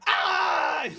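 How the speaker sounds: sudden high-pitched yell; mock scream. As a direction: burst out with a short, high "Ah!". Mock scream at front-page question.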